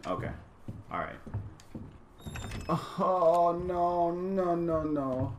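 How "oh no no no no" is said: high, drawn-out wail of alarm; dread. Real, not acted. Player seeing a threat coming in the game, real dread. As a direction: moan it high as danger closes in.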